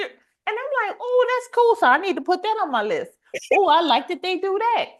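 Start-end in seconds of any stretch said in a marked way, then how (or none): 1.0-4.9 high, excited thinking aloud; eager inner voice. Retold or imitated. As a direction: act out delighted ideas popping up. Acts out own eager planning thoughts.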